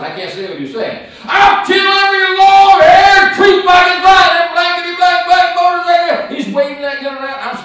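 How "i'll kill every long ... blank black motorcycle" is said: screaming threat. Retold or imitated. Bloodied gunman in the parking lot screaming threats at bikers. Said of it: "he's screaming".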